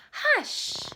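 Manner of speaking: shrill high hush. A narrator's reading. Folks hushing loud baby.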